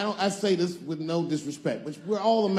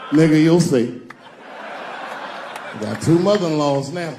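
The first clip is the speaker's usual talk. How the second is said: loud, lower gloomy [name] voice; 3 semitones lower, 6 dB louder than usual. Retold or imitated. [name] answering a friend on the street, loud and gloomy. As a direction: grumble it out like a weary [name]. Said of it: "what's up, [name]".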